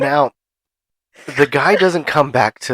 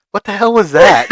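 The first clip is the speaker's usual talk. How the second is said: louder, higher, laughing through the quote; laughing outburst; 8 semitones higher, 5 dB louder than usual. Retold or imitated. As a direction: blurt it out while cracking up. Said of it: "He goes".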